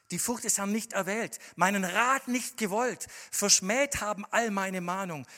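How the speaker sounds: loud, high scripture proclamation; preaching at full force. Acted. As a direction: declaim the verse to the hall.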